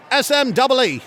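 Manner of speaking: high Australian voice; second Australian caller. Acted. Sid spells the contest word, high Australian accent. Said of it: "two completely separate accents".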